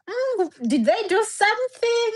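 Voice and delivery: high-pitched quoted wondering, mock surprise; puzzled wondering. Client recalls her reaction to the sudden title, puzzled wondering.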